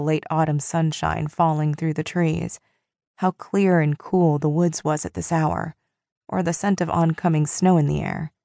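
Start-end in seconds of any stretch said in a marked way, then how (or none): none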